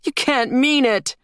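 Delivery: put-on high-pitched girl's protest; horrified disbelief. A narrator's reading. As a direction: plead with Dad in disbelief. Horrified girl protests to father.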